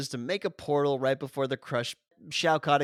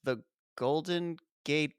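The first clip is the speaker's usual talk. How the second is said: ordinary talk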